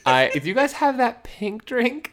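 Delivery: high-pitched, slow, sheepish retelling; embarrassed ordering. Retold or imitated. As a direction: act shy asking at the counter. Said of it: "I got really embarrassed".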